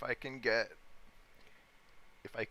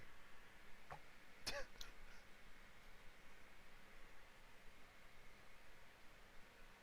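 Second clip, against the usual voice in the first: high squealing laugh; 12 dB quieter than usual. Real, not acted. Laughing at himself.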